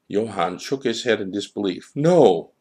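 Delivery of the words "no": loud, high denial shout. A narrator's reading. Johann refusing the child's words, a loud high shout. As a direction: cry out no in disbelief. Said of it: "he shouted".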